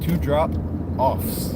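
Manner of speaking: very slow "drop-offs", stretching out "offs"; stress on OFFS. Shown as a demonstration. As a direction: drag out and lean on the "offs" in "drop-offs". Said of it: "emphasizing the off".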